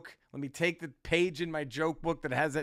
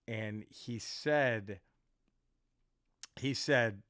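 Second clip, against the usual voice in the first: usual speaking voice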